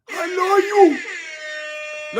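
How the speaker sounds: high, long-held "I love you" falling away; sing-song call, stretched right out; drawn-out love call. Real, not acted. Greeting a viewer called Chico, high drawn-out love call.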